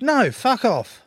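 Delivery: loud, high, drawn-out brush-off; jokey refusal. Retold or imitated. Player turning down a fan's photo request, loud jokey refusal. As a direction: reject it playfully at full voice. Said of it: "I didn't quite say".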